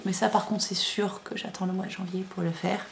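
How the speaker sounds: a soft whisper, like sharing a secret; confiding whisper. Real, not acted. Vlogger confiding a knitting plan, in a whisper. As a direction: lean in and whisper the promise.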